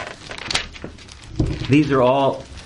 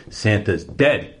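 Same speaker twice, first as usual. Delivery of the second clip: deadpan; slower than usual. Retold or imitated. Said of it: "she has her deadpan response".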